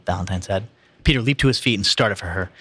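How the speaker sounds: ordinary delivery, nothing marked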